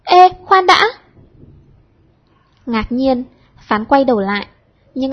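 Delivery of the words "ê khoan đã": loud, high call to stop; calling out sharply. A narrator's reading. Xuyến calls the departing poet back, loud and high. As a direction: call him back before he leaves. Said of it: "Xuyến đã gọi giật".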